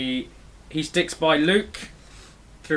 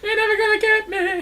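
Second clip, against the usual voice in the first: very high put-on mocking singsong; high singsong taunt. Friend imitating another version of the character, high singsong taunt. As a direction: tease in a high, silly cartoon voice.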